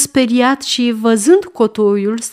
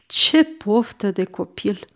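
softer, sighing regret; mild rueful lament; regretful; 6 dB quieter than usual. Acted. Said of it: "cu o nespusă părere de rău". (cu părere de rău)

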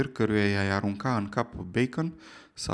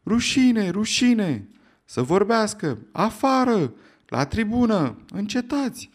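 high, loud jeering cries; crowd shouting; 8 semitones higher, 4 dB louder, slower than usual. Acted. The hall erupts against Challenger, high loud shouts. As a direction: hurl jeers from all sides.

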